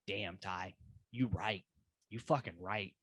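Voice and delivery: low, quiet concession; soft, low-voiced admission; hushed agreement. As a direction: murmur it like it just sank in. Acting own reaction to Ty's pitch.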